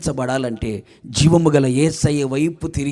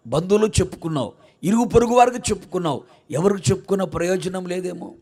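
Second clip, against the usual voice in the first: ordinary talk, nothing marked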